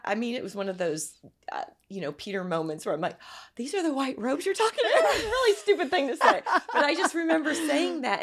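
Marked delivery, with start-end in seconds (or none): 3.4-5.2 gasping high and loud in wonder; amazed gasp. Retold or imitated. Her amazed reaction in vision. 5.2-8.2 laughing at herself. Real, not acted. Laughing at her own remark.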